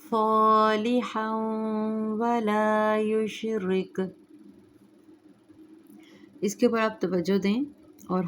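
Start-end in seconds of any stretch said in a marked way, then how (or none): 0.0-4.0 slow melodic tajweed chant; Quran recitation. Shown as a demonstration. Recites verse.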